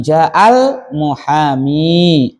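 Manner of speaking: "ja'al muhami" drawn out very slowly; very slow model reading. Shown as a demonstration. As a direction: stretch every syllable of "ja'al muhami". (dibaca sangat pelan sebagai contoh)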